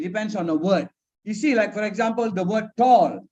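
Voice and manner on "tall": TALL as one long, louder beat; one-syllable demo. Shown as a demonstration. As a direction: say "tall" as a single drawn-out sound. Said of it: "one syllable only".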